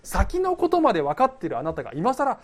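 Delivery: loud, high mock-outraged question; hammed-up protest to God; acted indignant challenge. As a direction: demand an explanation, speeding up at the end. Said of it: "言いたくなったんじゃないか".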